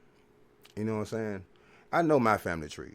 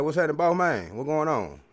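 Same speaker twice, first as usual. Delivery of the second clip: loud, lively greeting, a bit higher; greeting the store guys; 6 semitones higher, 5 dB louder than usual. Retold or imitated. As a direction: call out hearty hello to the shop guys. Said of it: "I'm like".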